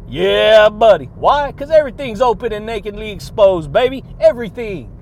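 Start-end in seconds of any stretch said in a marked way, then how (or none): 0.1-1.0 loud, punchy yeah buddy; big hype shout to the listeners. Real, not acted. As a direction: belt it out like a hype man.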